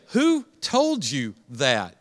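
slow, calm parent voice; gentle parent question. Shown as a demonstration. Pastor modelling a parent's reply to child, slow and calm. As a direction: ask it slowly like a caring parent. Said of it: "Say that with me".

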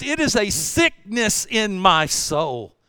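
slowed right down, weighty; slow, heavy. Real, not acted. Pastor confessing to his congregation, slow and heavy. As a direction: let each word sink.

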